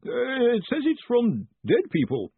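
high, anxious quaver; wavering, timid high voice. A narrator's reading. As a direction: break bad news fearfully. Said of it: "Collarbone quavered".

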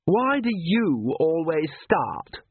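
high, drawn-out accusing question; petulant daughter challenging her father; demanding. A narrator's reading. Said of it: "she demanded".

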